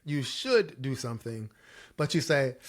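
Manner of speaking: ordinary talk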